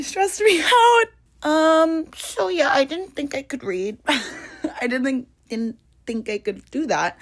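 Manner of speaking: high, giggly admission through laughter; nervous laughing confession. Real, not acted. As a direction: laugh at yourself while confessing. Laughing at her own anxiety.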